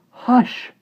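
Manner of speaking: plain reading voice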